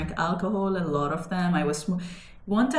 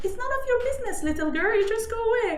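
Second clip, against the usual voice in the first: mocking police officer; 14 semitones higher, faster than usual. Police arresting girls, retold.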